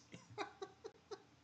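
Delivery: breathy high-pitched laugh; high giggle. Real, not acted. Streamer giggling at his own blunder. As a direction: burst into a squeaky giggle.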